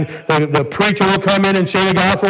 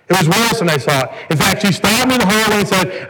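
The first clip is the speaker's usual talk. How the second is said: ordinary delivery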